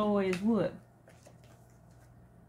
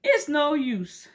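tearful lion; 9 semitones higher, 5 dB louder than usual. A narrator's reading. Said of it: "said the lion as he wiped away his tears".

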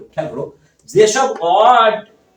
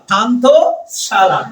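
"পান্থশালা" slowly, as পান্থ, pause, শালা; split into parts; 3 dB louder, much slower than usual. Shown as a demonstration. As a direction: break "পান্থশালা" into two drawn-out parts. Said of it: "পান্থ লাগাই দিবা".

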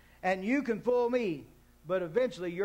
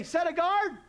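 congregation recital; 7 semitones higher, slower than usual. Shown as a demonstration. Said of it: "On the count of three".

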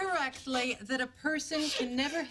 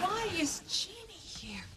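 whispered question, pitched up; urgent whisper; 6 semitones higher than usual. Acted. Woman asks a colleague why Jeannie is here, whispering. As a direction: whisper it so the visitor won't hear.

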